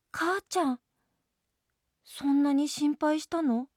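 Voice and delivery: put-on high-pitched little child voice; small child asking mother, voice pitched high; jellyfish child. A narrator's reading. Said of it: "聞き慣れたクラゲの子の声".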